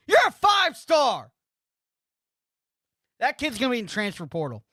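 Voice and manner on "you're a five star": mocking jab. Real, not acted. Mocking the player's status.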